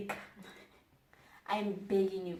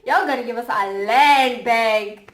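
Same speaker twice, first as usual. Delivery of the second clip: loud demand; 4 semitones higher, 9 dB louder than usual. Acted. Ranting to the audience with a loud demand. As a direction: lay down the law.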